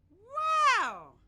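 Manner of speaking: loud, high delighted wow; excited wow. Real, not acted. Teacher cheering her students' drama, loud and high. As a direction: burst out with delight at the class.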